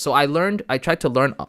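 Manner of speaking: ordinary talk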